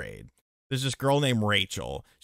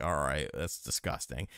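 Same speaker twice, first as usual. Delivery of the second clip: muttered disgust; 9 semitones lower, 8 dB quieter than usual. Retold or imitated. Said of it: "I'm just like".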